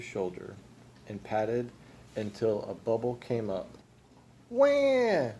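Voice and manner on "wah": high-pitched infant wail; baby ghost yell. A narrator's reading. Baby ghost yelling at Gus, high baby wail.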